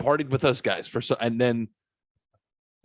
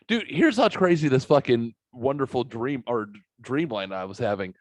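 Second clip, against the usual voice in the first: ordinary talk, nothing marked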